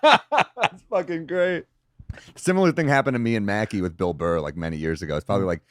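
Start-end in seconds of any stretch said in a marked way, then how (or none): 0.0-1.6 real laugh. Real, not acted. Laughing after the punchline.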